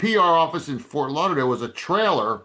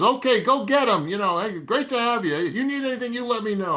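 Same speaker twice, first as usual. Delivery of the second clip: much higher, breezy pep-talk voice; beaming high farewell; cheery boss; 9 semitones higher than usual. Retold or imitated. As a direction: send the new guy off with gusto. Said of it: "He goes".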